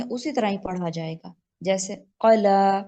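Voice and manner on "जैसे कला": कला drawn out slowly, louder; slow recitation example. Shown as a demonstration. Teacher reciting the example word slowly for her students. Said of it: "वक्फ की सूरत में भी कला ही पढ़ेंगे".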